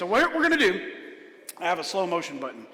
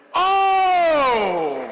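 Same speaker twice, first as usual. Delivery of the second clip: much higher, loud exclaimed oh; mock shock; 9 semitones higher, 8 dB louder than usual. Real, not acted. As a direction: react as if the answer stunned you. Magician reacts to wrong answer.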